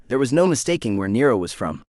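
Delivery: ordinary delivery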